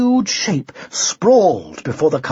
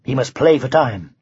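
plain reading voice

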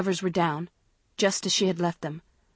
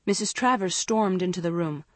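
ordinary delivery

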